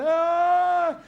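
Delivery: high, long-drawn yell for help; desperate cry for help. Acted. Man hanging from a branch calls upward, desperate drawn-out cry. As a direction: call far up a cliff, stretching each word.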